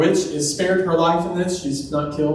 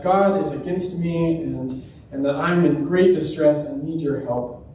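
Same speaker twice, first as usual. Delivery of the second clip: ordinary delivery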